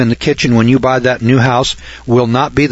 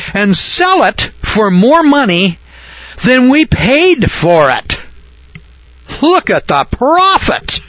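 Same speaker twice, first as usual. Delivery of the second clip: mock amazement; 9 semitones higher than usual. Lecturer mocking homeowners' pride, high hammed-up amazement. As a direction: gush over the profit in pretend wonder.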